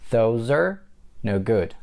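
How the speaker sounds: "Those are", pause, then "no good"; broken-down demo. Shown as a demonstration. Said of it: "That's the way you connect are".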